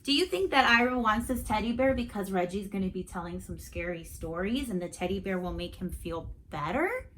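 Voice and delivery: ordinary delivery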